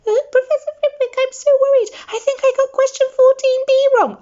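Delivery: mock Hermione in a squeaky falsetto; mock high voice. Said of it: "He put on a high voice".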